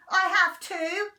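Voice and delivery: loud, high protest; Henry lying to Mum, loud and high. Acted. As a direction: insist it a bit too hard. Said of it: "lied Henry".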